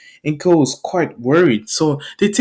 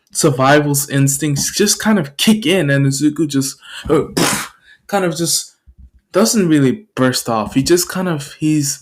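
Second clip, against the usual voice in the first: ordinary delivery, nothing marked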